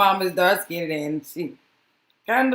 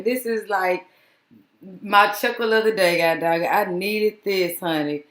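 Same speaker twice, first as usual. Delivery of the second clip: ordinary talk, nothing marked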